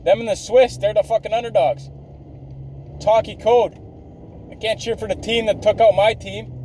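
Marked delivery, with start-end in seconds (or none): none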